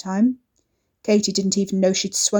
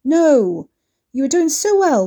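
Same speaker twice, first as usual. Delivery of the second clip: exasperated shout; 4 semitones higher, 7 dB louder, slower than usual. A narrator's reading. Ricky exasperated outburst.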